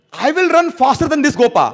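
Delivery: high, loud and slow with eager resolve; eager boast. Acted. Gopa running behind Krishna, loud eager and slow. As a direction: declare it like a keen young racer. Acting the gopas' running mood.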